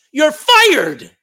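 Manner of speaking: loud, high outburst at the coach; punchy, high shout; shouted firing. Acted. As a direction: fire him with a big emphatic shout. Parent sacking a losing coach.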